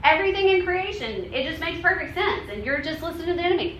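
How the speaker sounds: high mock voice of the accuser. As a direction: play the accuser in a high mocking voice, fast at the end. Also not over us saying.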